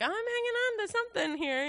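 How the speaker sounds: high, pinched clinging voice; cling on desperately; strained hope. Retold or imitated. Describing holding on to God's promises, high and strained. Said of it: "and you're like".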